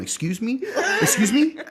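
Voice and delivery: high, rising disbelieving question; mock affronted. Host imitating friend misheard by waitress, mock affronted. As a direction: react as if just cussed at, hammed-up. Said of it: "as though she cussed at him".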